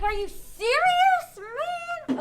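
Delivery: loud, high, drawn-out outrage; mad. Acted. Teacher acts out a mad man, angry outburst. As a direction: demand it angrily, leaning on "serious". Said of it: "So he's mad. He's like".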